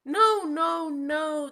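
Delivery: high, loud no no no; loud protest. Acted. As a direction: shout the denial. Monkey rejects bat.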